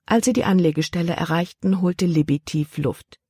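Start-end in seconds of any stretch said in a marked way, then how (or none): none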